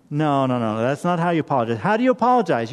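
ordinary talk

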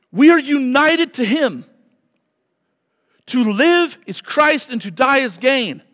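loud, high, fervent preaching; full-force preaching. Acted. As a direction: drive it home to the congregation.

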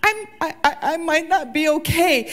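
high, halting, faltering confession; hesitant, trembling admission; breaking admission. Retold or imitated. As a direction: stumble into the words as if about to cry. Voicing the moment of breaking.

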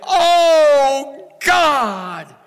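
high, loud pleading shout; crying out to God. Pretend. The church crying out to God, high and loud. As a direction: cry out, nearly breaking. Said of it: "stand up and say".

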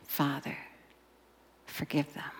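soft whispered plea; whispered prayer. Retold or imitated. Preacher whispers Jesus forgiving his killers. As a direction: whisper it tenderly.

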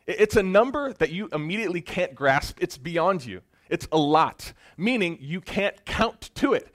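loud, high, emphatic preaching; preaching at full force. Real, not acted. Preacher hammering the huge number to the room, loud and emphatic. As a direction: pound the point home to the congregation. Preacher driving the point home.

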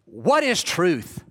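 slow, scoffing brush-off; dismissive sneer. Shown as a demonstration. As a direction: wave the question away with a pff. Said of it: "Do you hear it like this?".

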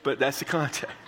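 ordinary delivery

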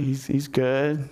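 slow, hesitant acted answer; awkward reply. Uriah answering the king's small talk, awkwardly. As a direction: stumble uncertainly through a short reply. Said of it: "this really awkward small talk".

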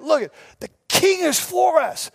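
high, fired-up rallying cry; inspiring rally. Retold or imitated. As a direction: rouse the troops with big news. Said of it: "he's inspiring them".

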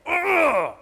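exasperated whiny ugh; annoyed high groan. Acted. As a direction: groan like a fed-up official.

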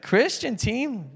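high, slow, scornful question; imitating a scoffing listener; mock incredulity. Retells the other man's scoffing.

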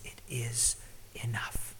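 slow, whispered and reverent; hushed whisper. Real, not acted. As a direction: drop to a whisper to let it land. Sermon climax, hushed delivery.

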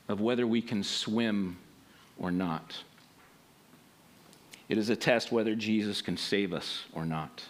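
soft, low preaching; quiet sermon close. Acted. Pastor closing the message to the congregation, soft and low. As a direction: draw the congregation in softly.

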